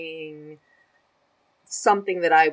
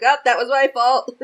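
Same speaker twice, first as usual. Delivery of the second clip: laughing; 8 semitones higher, 9 dB louder than usual. Retold or imitated. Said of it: "I was like".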